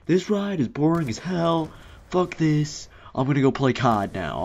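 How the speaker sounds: mock a whiny kid swearing; kid cussing. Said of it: "He's like".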